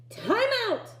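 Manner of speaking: high shouted call; a bright shouted time-out call; shouting. Acted. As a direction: call time out across the field. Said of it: "he shouted".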